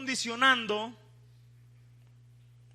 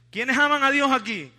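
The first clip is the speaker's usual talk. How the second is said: louder, higher rally to the congregation; rousing call; 4 semitones higher, 8 dB louder than usual. Real, not acted. Preacher asking the church to respond with confidence, rousing call. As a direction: call it out to draw a confident answer. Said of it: "responda con confianza".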